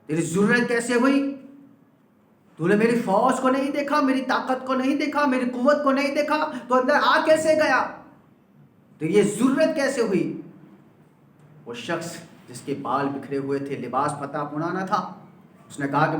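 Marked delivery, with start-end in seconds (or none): 0.1-10.4 an outraged king demanding how a beggar got through; angry king. Acted. King scolding the man who grabbed his reins, angrily. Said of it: "بادشاہ غصے میں آ گیا".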